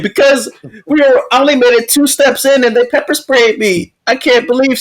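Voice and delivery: high-pitched mock distress; mock crying. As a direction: imitate a tearful, indignant protester. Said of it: "she's crying".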